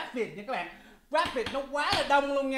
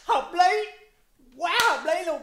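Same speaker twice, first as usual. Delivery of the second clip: much higher, loud excited approval; hyped up; 7 semitones higher, 8 dB louder, slower than usual. Real, not acted. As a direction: cheer it like the line blew you away. Hyped reaction to lyric. (phấn khích khen lớn)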